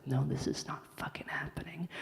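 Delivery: hushed, breathy inner whisper. Retold or imitated. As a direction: whisper it in disbelief. Inner voice of desperate mother.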